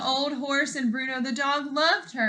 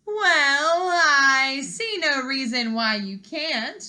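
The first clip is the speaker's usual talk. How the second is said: sly; 4 semitones higher, 8 dB louder, slower than usual. Acted. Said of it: "with a sly smile".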